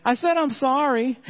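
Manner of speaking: put-on high, exasperated little girl; high childish protest. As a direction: play the five-year-old sister, fed up. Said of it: "Layla is saying".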